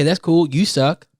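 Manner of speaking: ordinary talk, nothing marked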